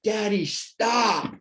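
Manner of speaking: put-on a little girl begging her father to stop; daughter screaming. Daughter begging her father to stop, pleading. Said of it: "she just screamed".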